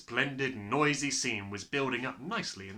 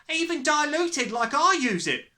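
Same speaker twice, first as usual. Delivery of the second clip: high, raised mother's voice; loud warning; 8 dB louder than usual. A narrator's reading. Mother telling her husband the danger, loudly. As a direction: press the warning home loudly.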